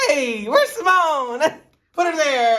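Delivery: slow, loud, hearty hello; slow loud mock hearty greeting, laughing; overeager greeting. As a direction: boom out a big hearty welcome. Overeager boyfriend greeting mom.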